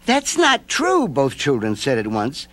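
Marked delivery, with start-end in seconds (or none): 0.1-1.1 put-on high, slow children's protest; child voices. A narrator's reading. Children insisting to the old man, high-pitched. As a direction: voice both kids at once, high.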